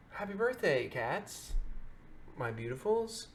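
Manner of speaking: soft, tender words to her pets; quiet loving pet talk; tender birthday wish. Real, not acted. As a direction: coo it gently to the cats. Greeting her cats on their birthday.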